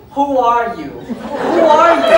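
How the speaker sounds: mock baffled questioning; bewildered who are you. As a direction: stare at a stranger in bed. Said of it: "thinking to yourself".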